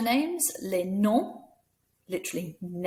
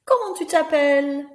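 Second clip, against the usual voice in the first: much higher and louder, a squeaky teddy; high puppet voice; 9 semitones higher, 8 dB louder than usual. Acted. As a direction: ask like an excited toy.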